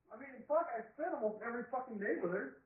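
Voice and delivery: a furious rant yelled at a friend; angry outburst. Real, not acted. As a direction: blow up in real frustration. Venting angrily about his partner.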